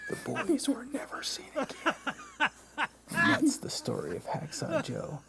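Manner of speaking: high, hushed eerie ending; playful spooky voice. Acted. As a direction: drop to a creepy hush.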